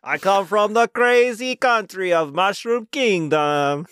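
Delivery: high, slow, hammed-up foreign accent; mock foreign Mario.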